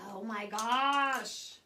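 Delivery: long, drawn-out exasperated groan of words. Real, not acted. Exasperated while searching for brushes.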